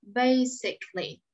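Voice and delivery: Basically slowly, BAY-sick-lee, no O; slow model pronunciation. Shown as a demonstration. Teacher repeats word slowly for the class. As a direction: drag out Basically, dropping the O. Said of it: "mình không phát âm ra".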